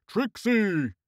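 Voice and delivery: high bawled call that falls away; high-pitched calling bawl. A narrator's reading. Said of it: "Horseface bawled".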